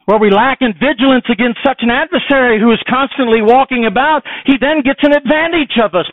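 high, loud, driving preacher's cry; preaching at full force. Acted. Preacher warning the congregation of Satan, loud and high. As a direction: hammer the warning home to the church.

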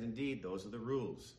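normal talking voice

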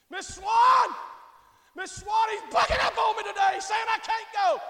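acted urgent complaint. Wife calling Miss Swan about her husband, urgent shouted complaint. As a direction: holler out to a friend in protest.

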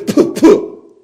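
two puffed spitting noises; mock spitting sound. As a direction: pretend to spit at him. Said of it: "I spit on you".

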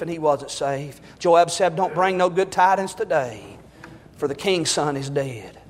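low, soft, grieving preacher voice; mournful hushed telling; sorrowful hush. Real, not acted. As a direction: let the tragedy sink into near silence. Tragic news told in sorrow.

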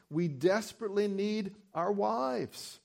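normal talking voice

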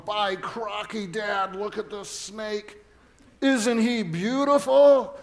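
put-on light Aussie crocodile-hunter voice from a boy; son's Steve Irwin impression. Boy showing his dad a snake, in a mild Australian accent.

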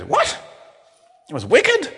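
grandma aghast at youth slang, high shocked question. Pretend. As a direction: recoil in horror at the word wicked. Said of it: "She goes".

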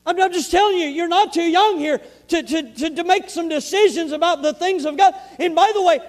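loud, high, driving sermon voice; fiery preaching. Real, not acted. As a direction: preach it out with full force. Preaching at full force.

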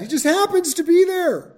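mock disbelief at how conveniently he shows up; incredulous. Real, not acted. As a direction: sound amazed at the coincidence. Mocking Jonadab's convenient timing.

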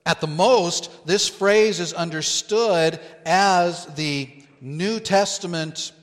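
ordinary talk

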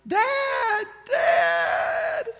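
high, loud, desperate cry for Dad; a daughter in the water screaming for her father; imitated child screaming. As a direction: cry out for Dad like a terrified girl. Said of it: "the last time I heard the scream was".

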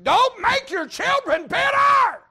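slow, huge high shout; preaching shout. Acted. Preacher shouting the saying God gave him, slow and loud. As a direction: thunder the warning slowly. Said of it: "I kept hearing this saying".

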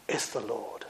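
hushed and low, full of wonder; John's hushed recognition; awed hush. Acted. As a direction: breathe it out in awe. Preacher echoes with awe.

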